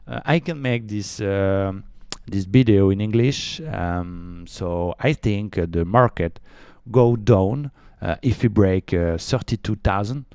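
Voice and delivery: slow, halting French-accented English; hesitant English with a French accent; showy English accent. Shown as a demonstration. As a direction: show off your English, word by painstaking word. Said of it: "J'ai un accent anglais de ouf".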